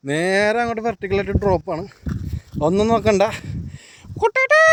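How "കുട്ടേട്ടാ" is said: high pleading call for help. Pretend. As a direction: shout the name up high. Calling out for help on cliff.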